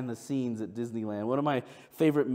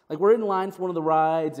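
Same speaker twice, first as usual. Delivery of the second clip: normal talking voice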